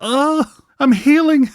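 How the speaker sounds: high-pitched, hammed-up healing rapture; overjoyed relief. Acting out relief at the show.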